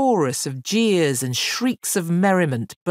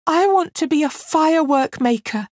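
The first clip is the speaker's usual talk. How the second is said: put-on much higher, strained girl's voice; struggling girl; 13 semitones higher, 5 dB louder than usual. Girl forcing out her wish to the fire fiend, high and strained. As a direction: force the words out through the heat. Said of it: "she managed to say".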